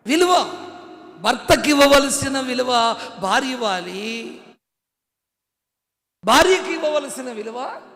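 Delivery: loud, high preached lines; fiery preaching. Acted. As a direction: drive the point home. Preaching at full force.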